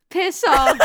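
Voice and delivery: loud, high playful protest; fake-indignant bark; mock outrage. Host mock-scolding her girlfriend's joke, loud and laughing.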